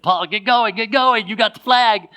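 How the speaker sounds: a high, loud, hurried imitated shout; urging shout. Teammates behind him urge him to move, high loud urging.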